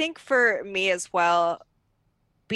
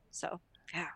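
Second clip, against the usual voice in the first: whispering; 13 dB quieter than usual. Real, not acted. Trailing off to a whisper.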